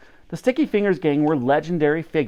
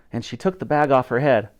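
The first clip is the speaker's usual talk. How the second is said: ordinary delivery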